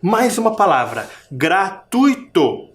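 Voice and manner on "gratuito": Gratuito slowly, TUI lifted and held; stress on TUI. Shown as a demonstration. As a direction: lean on TUI in GRA-TUI-TO. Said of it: "O som parece que vem mais forte". (Gratuito devagar, destacando TUI)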